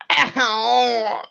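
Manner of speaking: high yelp of pain; hit hard crying out. Acted. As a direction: cry out as if struck. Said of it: "doubles over in pain".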